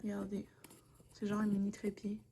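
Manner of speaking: soft secret whisper. Real, not acted. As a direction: whisper it like showing a little secret. Showing new tripod, voice dropped.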